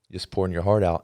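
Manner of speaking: normal talking voice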